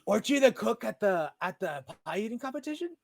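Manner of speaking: high, eager question; excited recognition. Acted. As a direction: blurt it out as you recognise them. Character recognises the pie cook.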